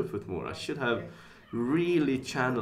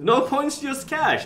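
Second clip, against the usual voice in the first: excited; 14 semitones higher, 6 dB louder, slower than usual. Real, not acted. Insists on cash to chat.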